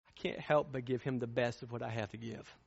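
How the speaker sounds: ordinary delivery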